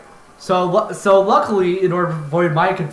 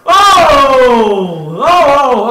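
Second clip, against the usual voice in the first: high, loud oh oh; loud excited cry; 6 semitones higher, 8 dB louder, much slower than usual. Real, not acted. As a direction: shout with excitement. Reacting to play loudly.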